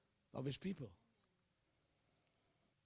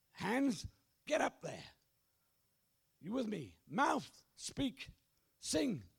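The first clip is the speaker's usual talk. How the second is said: fervent preaching; 8 semitones higher, slower than usual. Real, not acted. Urging congregation to praise.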